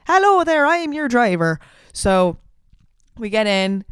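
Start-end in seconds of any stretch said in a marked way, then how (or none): 0.1-1.6 put-on higher, louder Irish accent greeting; a put-on Irish accent, bright and hearty. Driver greeting the tourists in a lightly imitated Irish accent. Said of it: "well, he has an Irish accent".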